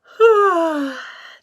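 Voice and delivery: big loud tired sigh. Acted. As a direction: heave a long sigh. Amos about to nap.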